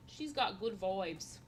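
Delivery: slow, put-on Australian accent. Streamer repeating a compliment in a playful Australian accent. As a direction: stretch "vibes" towards "voibes", mock Australian. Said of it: "always talking in different accents".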